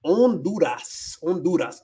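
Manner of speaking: Honduras as on-DOO-ras, first slow; enjoy Honduras with a silent H; relished Spanish pronunciation. Shown as a demonstration. She loves saying that word, Honduras without H. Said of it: "I love saying that word".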